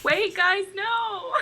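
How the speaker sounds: shouted high plea; high-pitched cry of wait; high call after them. Acted. Player shouts after fleeing gnomes.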